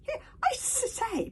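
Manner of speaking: high, loud stammered objection; squeaky little dragon protest; protesting Toothless. A narrator's reading. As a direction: splutter indignantly, very high. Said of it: "protested Toothless".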